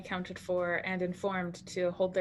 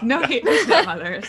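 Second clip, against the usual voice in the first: laughing; 7 semitones higher, 13 dB louder than usual. Real, not acted. Laughing apology.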